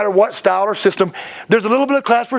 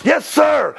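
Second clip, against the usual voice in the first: shouted yes sir; 6 semitones higher than usual. Retold or imitated. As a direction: bark it back like cadets. Students answering the instructor.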